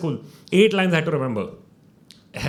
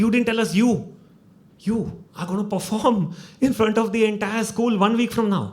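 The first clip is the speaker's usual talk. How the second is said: mock parents' high excited voice, a touch louder; excited parents; 8 semitones higher, 4 dB louder than usual. Parents tell their son about the school show, excited. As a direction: gush like proud parents.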